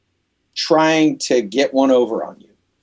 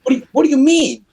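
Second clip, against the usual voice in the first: high, fast, incredulous question; exasperated disbelief; 11 semitones higher, faster than usual. Retold or imitated. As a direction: throw it back at him in disbelief. Angry at label president.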